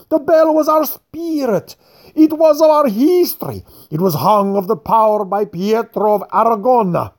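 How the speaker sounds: put-on proud old man, indignant, lecturing the fat one; furious old man. A narrator's reading. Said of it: "broke into furious Italian".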